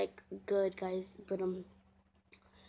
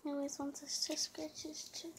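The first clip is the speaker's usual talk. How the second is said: much softer, whispered; 4 semitones higher, 10 dB quieter than usual. Real, not acted. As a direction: keep your voice to a whisper. Whispering near the dog.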